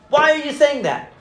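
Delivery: hammed-up aggressive grilling; combative challenge. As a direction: imitate a pushy therapist firing back at a patient. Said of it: "He was combative".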